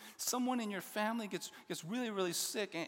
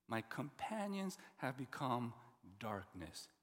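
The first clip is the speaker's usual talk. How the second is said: slow, falling, softer bleak ending; somber reading; 8 semitones lower, 6 dB quieter than usual. Acted. Preacher rereading the psalm's last word darkness somberly. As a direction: let darkness sink low and quiet.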